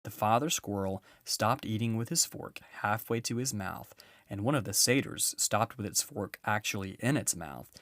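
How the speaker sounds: ordinary delivery, nothing marked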